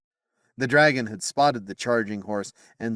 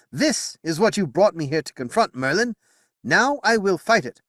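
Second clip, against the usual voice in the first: higher, louder solemn king; determined king; 10 semitones higher, 5 dB louder than usual. Acted. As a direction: declare it like a verdict. Arthur voiced in narration.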